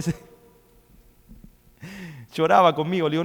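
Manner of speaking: normal talking voice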